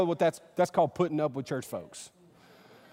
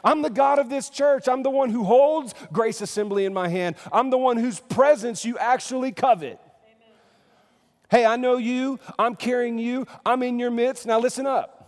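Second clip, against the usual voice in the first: higher, louder, commanding declaration; bold voice of Jesus; 7 semitones higher, 6 dB louder than usual. Pretend. Pastor voicing Jesus addressing the church, loud and commanding. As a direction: announce yourself as Lord of the house. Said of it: "We want Jesus to start out by going".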